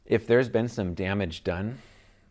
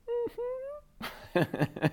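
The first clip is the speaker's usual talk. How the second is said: put-on child's teary mm-hmm; 4 dB quieter than usual. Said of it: "And he goes".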